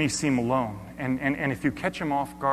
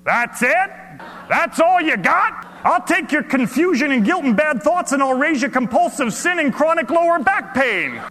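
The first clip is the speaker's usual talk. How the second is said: high, loud teasing shout; yelling from the back; 10 dB louder than usual. Acted. Stranger yelling back a joke across the room, loud and teasing. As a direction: call out a playful one-up from the back. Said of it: "this voice yelling".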